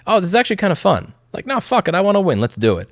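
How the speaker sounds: high, mocking impression of Elon getting into it. Elon voiced getting hooked.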